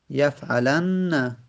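یفعلن said very slowly, doubled final nun held: yafʿalanna. Shown as a demonstration. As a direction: stretch یفعلن, lean on the doubled nn at the end.

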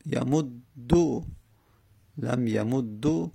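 یمود دو read slowly "yamud-du"; damma ending. Shown as a demonstration. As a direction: stretch یمود دو over the double d into a final u. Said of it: "چون که دمه داشت دمه تونستیم بدیم".

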